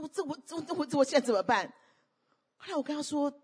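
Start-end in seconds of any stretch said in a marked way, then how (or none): 0.0-1.7 retold tearful plea. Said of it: "她就哭".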